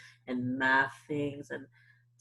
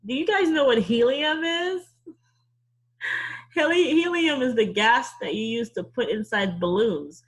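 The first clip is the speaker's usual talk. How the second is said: higher and louder, bright storytime energy; lively teacher; 5 semitones higher, 6 dB louder than usual. Acted. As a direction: keep the kids hooked. Lively explaining to kids.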